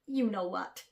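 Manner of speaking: usual speaking voice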